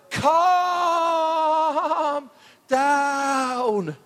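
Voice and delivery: Michael Winner impression. Preacher hushing the band in a mock Michael Winner voice. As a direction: stretch it out like a fussy old scold. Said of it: "the Michael Winner advert".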